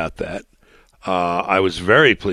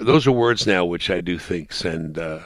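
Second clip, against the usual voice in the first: ordinary talk, nothing marked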